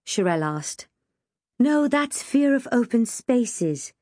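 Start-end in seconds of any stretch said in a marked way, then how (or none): 1.6-3.9 slow, higher-pitched correction; authoritative. A narrator's reading. Gina correcting her friend on phobias, authoritatively. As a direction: correct them like an expert. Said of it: "Gina replied authoritatively".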